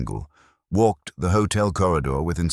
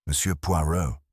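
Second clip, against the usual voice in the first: nervous and out of breath; rushed, airy nervous greeting; breathless. A narrator's reading. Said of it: "he began breathlessly".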